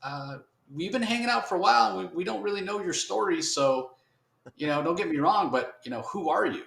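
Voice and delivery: high mock voice talking someone down; calm him down in a high put-on voice; placating buddies done in a high silly voice; soothing street buddies. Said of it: "and they're like".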